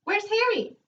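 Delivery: high squawky parrot call. Acted. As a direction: squawk it out like a talking bird. Parrot speaks in story.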